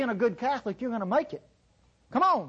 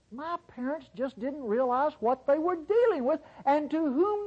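high-pitched tongue-in-cheek grandiosity; sarcastically pompous, pitched up; mock self-importance; 5 semitones higher than usual. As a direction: boast in a higher voice, playing the know-it-all teenager. Mock boasting about his teenage self.

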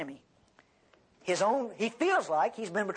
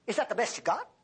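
high-pitched, disbelieving objection; incredulous complaint; 9 semitones higher than usual. Acted. Listener objecting to God about this teacher, incredulous. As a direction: question God's choice in a raised voice. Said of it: "you could say the same thing".

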